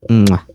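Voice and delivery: loud smooching kiss into the mic. Pretend. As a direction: blow a big kiss up close. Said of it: "Give us a kiss up close".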